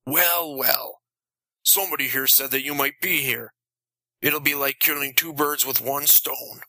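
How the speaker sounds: lightly voiced vampire, darkly amused; inhuman villain. A narrator's reading. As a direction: toy with your prey, as if nearly laughing. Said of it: "in an inhuman voice, nearly laughing".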